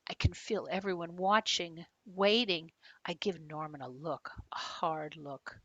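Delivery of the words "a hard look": ordinary delivery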